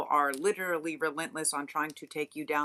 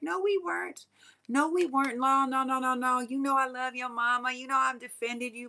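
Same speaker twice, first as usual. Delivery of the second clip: much higher, whiny fake-friend voice; mock pleading denial; 9 semitones higher than usual. Fake friends begging, in a high whiny mock voice. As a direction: squeal out the phony denial.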